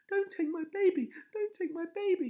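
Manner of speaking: high, loud desperate pleading; pleading cry. A narrator's reading. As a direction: beg through tears. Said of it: "she pleaded".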